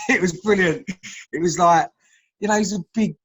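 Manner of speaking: delighted laugh through the words. Real, not acted. Laughing at the fond memory.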